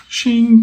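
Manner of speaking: high, drawn-out blade noise; spoken sword-unsheathing sound effect; imitated metallic shing. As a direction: draw out a high metallic ring. Imitates trident being grabbed.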